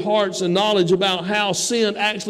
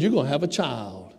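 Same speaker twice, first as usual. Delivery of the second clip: God speaking; 7 semitones lower, 6 dB quieter than usual. Acted. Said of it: "because God said".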